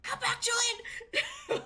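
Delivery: high, loud laughing call; Alyona's scream. Retold or imitated. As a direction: call him back with a laughing yell. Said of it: "this fucking loud scream of Alyona".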